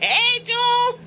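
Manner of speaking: squeaky high teasing call; friends calling out. Retold or imitated. Friends at the picnic teasing Jules, high singsong call. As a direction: sing it out high to tease her.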